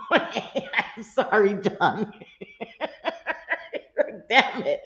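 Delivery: slow, apology squeezed out through laughter; words dissolving into giggles; cracking up. Real, not acted. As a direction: try to apologize while really laughing. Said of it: "I'm cracking up".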